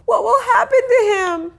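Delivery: high, weepy worried question; crying girl. A narrator's reading. Sheila cries to the mother, high and weepy. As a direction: whimper it anxiously. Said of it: "Sheila cried".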